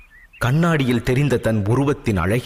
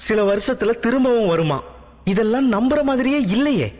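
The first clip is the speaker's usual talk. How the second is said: high, fast proud scoffing; a proud girl fuming to herself; daughter's indignant thoughts; 7 semitones higher than usual. A narrator's reading. Daughter alone in her room fumes, high and proud. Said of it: "என்று எண்ணிக்கொண்டிருந்தாள்".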